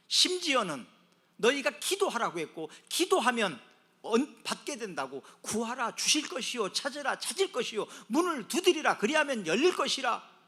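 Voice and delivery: loud, high-pitched preaching; fervent preaching. Acted. Preacher urging the congregation to ask and knock, fervently. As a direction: drive each promise home forcefully.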